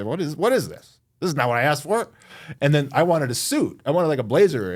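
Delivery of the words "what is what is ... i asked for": higher, a lightly voiced indignant toddler; mild mock toddler complaint, slightly raised pitch; indignant little kid. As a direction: play the three-year-old let down by his gift, a touch higher. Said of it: "three-year-old say".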